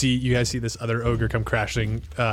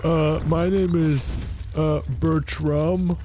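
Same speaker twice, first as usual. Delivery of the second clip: higher, slow ogre voice; shy ogre; 4 semitones higher, slower than usual. Acted. Ogre Bertram introducing himself, shy. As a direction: say your name like a shy giant. Ogre gives his name.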